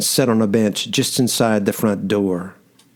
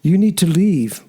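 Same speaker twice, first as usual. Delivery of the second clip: higher, tearful plea to leave; starting to cry; 7 semitones higher than usual. Acted. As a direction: beg them to go, voice starting to break. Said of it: "but now she was starting to cry".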